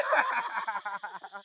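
high-pitched burst of laughter; laughing. Acted. Crony laughing at boss's remark.

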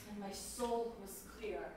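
hushed confession of relief. Acted. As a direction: let the words fall to a hush. Tender memory of crying.

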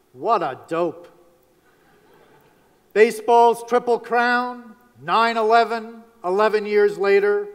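punchy, declaimed year-in-review headlines; measured newsreel-style announcing with pauses between items; headline recital. Acted. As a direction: proclaim each item as a separate headline.

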